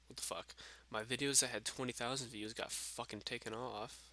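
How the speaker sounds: ordinary talk, nothing marked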